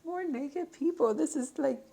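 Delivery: high laughing panic over naked cards; flustered laughing. Real, not acted. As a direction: squeal it out, amused and flustered. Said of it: "it's giving me a little bit of anxiety".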